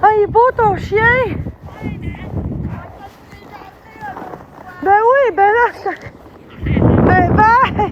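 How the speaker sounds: high, loud friendly greeting voice; calling out cheerfully. Real, not acted. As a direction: call across the yard to a neighbour. Calling out to the neighbour.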